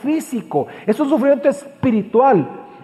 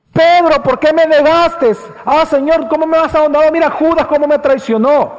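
mock complaining Jesus; 5 semitones higher, 3 dB louder than usual. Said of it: "No es una queja del dolor físico".

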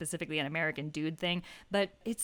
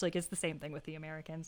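ordinary delivery

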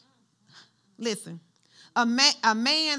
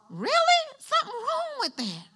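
much higher incredulous "really"; 13 semitones higher than usual. Real, not acted. Preacher mocking adults acting thirteen, incredulous. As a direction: disbelief at a grown adult's behaviour.

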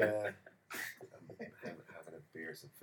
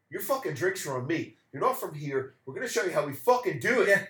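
high, loud, laughing boast; loud hearty local; 8 semitones higher, 13 dB louder than usual. Retold or imitated. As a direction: boom it out like a generous host. Local treating the stranger.

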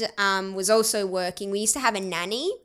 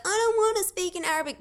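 put-on high, quick childlike protest; mock tearful whine; 10 semitones higher, faster than usual. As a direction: imitate your younger self crying in complaint. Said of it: "I used to cry and be like".

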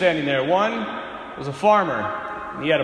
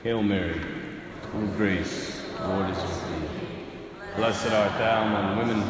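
low, hushed, measured prayer voice; led prayer; 11 semitones lower, 7 dB quieter than usual. Acted. Leads the Hail Mary prayer.

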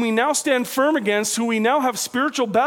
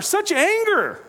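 normal talking voice

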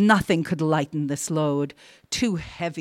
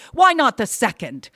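raised, loud, eager question; feverish insistence; 5 semitones higher, 6 dB louder than usual. A narrator's reading. Wife argues for another wish, loud and feverish. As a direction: push the argument eagerly. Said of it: "said Erda feverishly".

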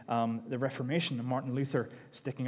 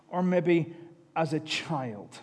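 usual speaking voice

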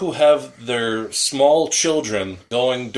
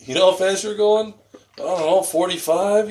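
movie scene quote; 7 semitones higher than usual. Retold or imitated. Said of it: "the Cheech and Chong movie".

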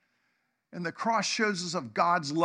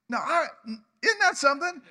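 much higher and louder, voice breaking with emotion; choked-up amazement; 7 semitones higher, 5 dB louder than usual. Real, not acted. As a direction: marvel aloud, almost crying. Preacher moved by God's answer.

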